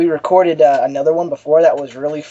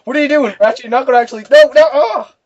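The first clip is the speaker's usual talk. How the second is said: higher, rising panic into a cry; panicked Optimus; 7 semitones higher, 4 dB louder, faster than usual. Retold or imitated. Optimus pleading with Ratchet, panicking, ends in a yelp. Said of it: "Optimus combined with Jetfire's like".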